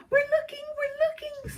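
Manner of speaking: tiny, very high squeaky monkey voices; little monkeys. A narrator's reading. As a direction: pipe up as little monkeys, far above your own pitch. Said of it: "said the six little monkeys".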